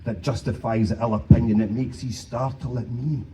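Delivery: loud, declaimed Scots verse; poem recitation. Acted. Performer reciting Burns to the folks, loud dramatic recitation.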